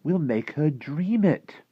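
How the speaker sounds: slow, emphatic reveal rising high; drawn-out girlish eagerness; excited. A narrator's reading. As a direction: build eagerly to the big idea. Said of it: "Sophie said excitedly".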